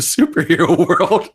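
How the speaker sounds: high, loud break into laughter while reading; reading that cracks up into laughter; trying not to laugh. Real, not acted. Podcaster reading a film pitch to co-host, cracking up. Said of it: "can't even get through without laughing".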